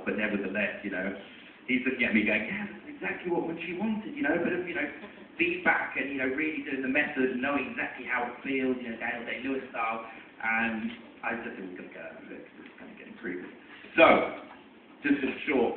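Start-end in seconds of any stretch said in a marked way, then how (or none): 2.5-10.0 imitated co-star. Co-star quoted mid-scam. 11.7-13.5 hushed nervous aside. Retold or imitated. Scared aside to co-star.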